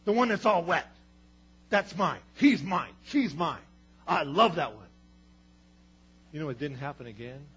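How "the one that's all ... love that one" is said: loud, glad proclaiming; proud fatherly shout. Acted. God looks down on his wet child, loud and proud.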